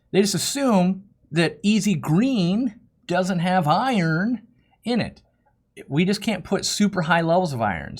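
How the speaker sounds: ordinary talk, nothing marked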